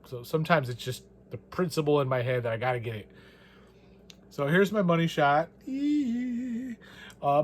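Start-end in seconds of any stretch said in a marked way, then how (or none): 5.7-6.8 excited squeal. Real, not acted. Excited about money shot.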